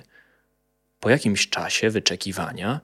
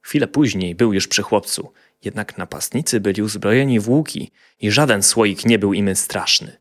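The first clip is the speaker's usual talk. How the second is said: plain reading voice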